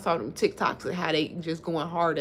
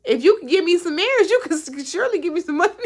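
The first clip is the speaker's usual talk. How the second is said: mock pleading; 10 semitones higher, 8 dB louder than usual. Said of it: "but I be like".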